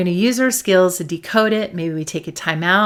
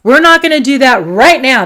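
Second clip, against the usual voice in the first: louder, higher, fired-up refusal; indignant anger; 5 semitones higher, 8 dB louder than usual. Acted. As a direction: put your foot down as fed-up Anger. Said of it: "and she's like".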